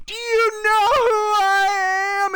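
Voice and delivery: high, drawn-out mock shriek; imitated indignant shriek, stretched out. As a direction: shriek it long and high like a horror-movie scream. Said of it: "she shrieked".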